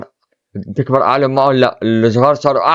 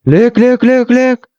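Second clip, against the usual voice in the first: excited; 10 semitones higher, 3 dB louder than usual. Real, not acted. Excited, showing the price.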